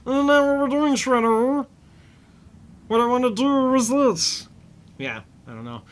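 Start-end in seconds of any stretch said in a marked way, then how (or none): 0.0-4.5 high-pitched cartoon villain voice; hammed-up villain cutting off a henchman, pitched way up; Krang. Villain scolding Shredder mid-monologue over the loudspeaker, high hammy voice. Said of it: "Krang gets over the loudspeaker".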